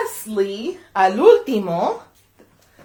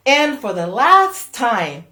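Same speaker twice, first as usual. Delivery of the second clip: ordinary delivery, nothing marked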